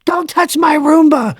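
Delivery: very high, mock-frantic shout; imitated warning yell. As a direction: shriek it like a coworker guarding a prized possession. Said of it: "I like when you were yelling".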